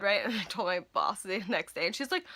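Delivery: normal talking voice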